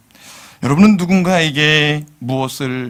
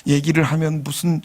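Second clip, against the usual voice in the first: ordinary delivery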